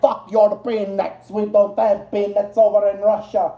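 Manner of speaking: put-on a light, shaky stab at a Russian accent; sloppy imitation of a Russian accent; mock Russian accent. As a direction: play a Russian soldier in a half-hearted Russian accent. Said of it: "I don't know if that's a Russian accent".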